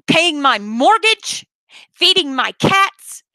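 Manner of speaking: indignant telling-off with hard-hit stressed words; exasperated, emphatic outburst; real angry rant. Real, not acted. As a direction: scold them all, utterly fed up. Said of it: "I'm sorry, I'm angry".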